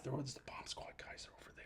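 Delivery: hushed secretive whisper. Retold or imitated. Pointing to secret hut.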